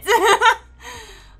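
high burst of laughter; big real laugh. Real, not acted. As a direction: let the laugh burst out loud. Laughing at own joke.

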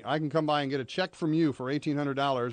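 normal talking voice

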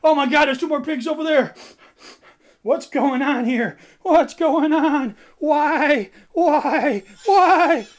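high loud panic; rising frantic alarm; panicked. Acted.

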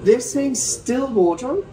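slow, drawn out They're saying still water; slow emphasis. Shown as a demonstration. Host slowly correcting the guest that it's still water. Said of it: "correcting the guest's sparkling claim".

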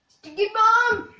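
very loud, high, slow call; hyped shout. Real, not acted. Gamer yelling his move name, slow, high and loud. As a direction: bellow it out like a battle cry.

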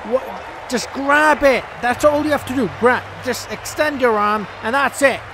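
loud, high, exasperated telling-off; exasperated scolding. Real, not acted. Player scolding his fielder for a dropped catch, loud exasperation. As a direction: bark it at the clumsy fielder.

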